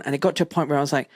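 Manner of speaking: ordinary delivery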